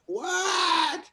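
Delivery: high, loud stunned What?; shocked shout. Retold or imitated. Hearing a mother's tragic story, a high, loud, stunned What?. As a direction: blurt it out in disbelief. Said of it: "I said".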